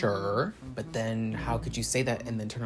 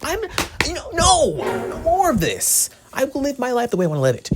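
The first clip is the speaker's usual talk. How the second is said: loud, high cry of refusal; dramatic high-pitched outburst; theatrical defiant shout; 13 semitones higher, 9 dB louder than usual. Pretend. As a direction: belt out your defiance.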